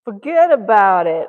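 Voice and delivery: put-on loud Italian-American New York accent. Reply to someone with the same thought, loud New York Italian accent. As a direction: belt it out like an Italian New Yorker. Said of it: "thinking the same thought about Italian, Italian American".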